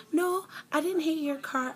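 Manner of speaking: high, loud mocking denial. Mocking the other driver's denial, high and loud. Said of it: "She's like".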